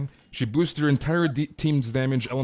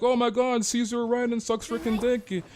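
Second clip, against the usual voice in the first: much higher, squeaky taunting parody; shrill-free high mock voice ending in louder lols; 11 semitones higher, 3 dB louder, slower than usual. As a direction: whine it like a smug troll.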